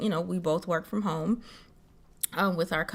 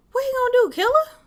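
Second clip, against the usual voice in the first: high sarcastic scoff; 5 dB louder, faster than usual. Acted. Sarcastic retort.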